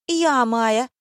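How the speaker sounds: high, loud, clear ringing reply; ringing girlish voice. A narrator's reading. Blonde in red introducing herself to the director, ringing. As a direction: pipe up brightly and high. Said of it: "звонко произнесла блондинка".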